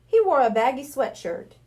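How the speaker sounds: ordinary delivery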